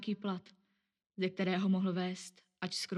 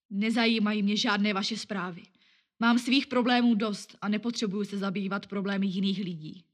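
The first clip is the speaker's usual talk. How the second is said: louder, irritated rebuff; even nastier tone; 7 dB louder than usual. Acted. Man rebuffs newspaper girl again, louder and irritated. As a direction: push her off, annoyed. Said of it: "ještě protivnějším tónem".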